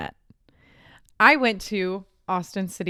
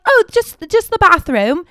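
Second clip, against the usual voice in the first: imitated British stranger; 8 semitones higher, 6 dB louder than usual. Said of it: "And he was like".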